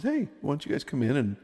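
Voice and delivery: friend's mom. Retold or imitated. Friend's mom offering breakfast to boys, lightly imitated mother. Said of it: "but his mom said".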